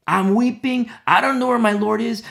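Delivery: anguished cry. Acted. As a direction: cry out as Mary at the tomb. Voicing Mary's anguished plea.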